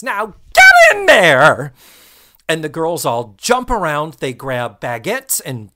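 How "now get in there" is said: loud, drawn-out furious yell; screaming order. Retold or imitated. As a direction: bellow the order at the girls. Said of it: "Missus Garrett just screams".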